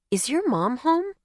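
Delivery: put-on small, high child's question; little girl voice. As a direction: play a shy little girl.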